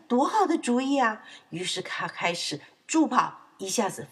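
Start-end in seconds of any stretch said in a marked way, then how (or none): 0.1-1.2 higher, eager piglet exclamation; delighted. Acted. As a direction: burst out with a bright idea. (兴奋地赞叹)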